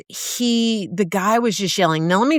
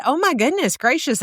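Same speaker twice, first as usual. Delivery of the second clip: amazed gasp; 7 semitones higher, faster than usual. Real, not acted. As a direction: pitch leaps up in delighted astonishment. Mom reacting to son's story.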